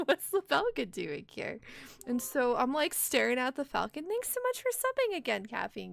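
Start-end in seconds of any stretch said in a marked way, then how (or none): 0.0-1.6 high, loud, giggling "what"; laughing disbelief. Retold or imitated. Seeing a falcon at the window, high laughing disbelief. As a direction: crack up at the sight. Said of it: "And I'm like".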